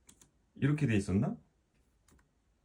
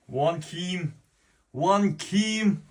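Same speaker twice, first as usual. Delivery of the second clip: rising, high, louder shouting of the name; winner announcement; 8 semitones higher, 5 dB louder, much slower than usual. Real, not acted. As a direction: call out the winner, building up.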